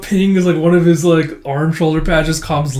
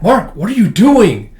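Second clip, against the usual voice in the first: higher, louder, startled objection; shocked reproach; 7 semitones higher, 7 dB louder than usual. Retold or imitated. Priest recoils from Mark's hand on top of theirs, shocked. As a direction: act out a startled, drawn-out protest.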